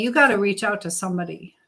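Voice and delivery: ordinary talk, nothing marked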